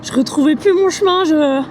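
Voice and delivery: high, breathless relief; relieved. Real, not acted. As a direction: let the relief burst out. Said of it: "le soulagement".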